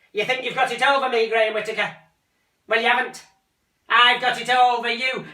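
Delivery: loud high rant; screaming. Acted. Mother screaming at son outside the bedroom door. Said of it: "comes screaming".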